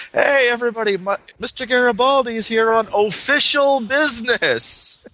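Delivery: high, sing-song announcing. Retold or imitated. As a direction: announce his arrival to everyone. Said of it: "Yeah, kind of".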